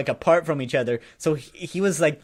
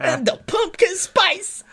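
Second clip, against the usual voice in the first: very high, slow, loud relish; imitated excitement; 5 dB louder, slower than usual. Homeless guy naming his favorite flavor, imitated as thrilled. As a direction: stretch it into a delighted squeal. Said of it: "so excited about it".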